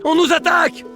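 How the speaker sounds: alarm shout. Acted. Alarm call in battle game.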